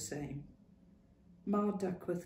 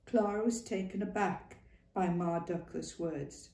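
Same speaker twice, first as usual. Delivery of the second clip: plain reading voice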